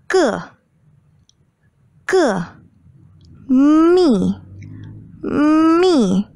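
Ma, Ge, Mi, Wu start high and drop sharply; falling fourth tone. Shown as a demonstration. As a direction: throw Ma, Ge, Mi, Wu down from high, as if angry. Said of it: "starts from high and drops sharply".

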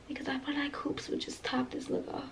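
soft whisper to herself; real whisper. Real, not acted. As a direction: murmur it to yourself, hushed.

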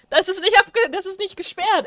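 high, loud indignant complaint; indignant protest. Real, not acted. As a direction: protest at the lazy boss. Beschwert sich über den Chef. (empört und laut)

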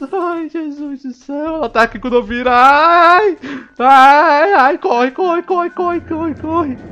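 high panicked cries to run; terrified shriek. Real, not acted. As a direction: shriek in real terror. Monster right behind him.

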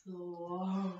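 drawn-out and soft; slow. Shown as a demonstration. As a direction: stretch the words out slowly. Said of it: "Not too fast".

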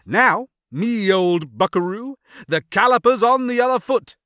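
high, gloating explanation; Rimmer. Acted. Rimmer gloats that Lister's luck has turned, crowing. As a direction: begin with a loud high denial, then crow over him.

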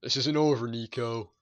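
a hint of an old-school gangster warning; Italian American gangster. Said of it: "That was my Italian American".

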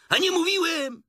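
very high, loud told-you-so; triumphant Tigger. A narrator's reading. Tigger proved right to friends, loud high triumph.

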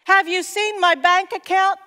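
hammed-up disbelief aimed at Paul; mock exasperated protest. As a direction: pretend complaint to Paul about money, mock exasperated. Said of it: "And I want to say".

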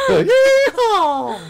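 high whooping cowboy yeehaw. Acted.

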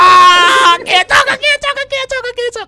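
high, loud hammed-up wail; loud, high play-acted despair; mock wailing. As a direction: fake a dramatic breakdown, howling the words. Mock meltdown over phone call.

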